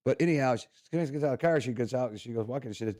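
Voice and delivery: ordinary talk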